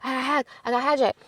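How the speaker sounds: high-pitched panicked cry; retold panic. Vlogger reliving the hack for viewers, high panicked cry. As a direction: squeal it out like the account is already gone. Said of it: "I was like".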